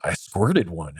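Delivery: normal talking voice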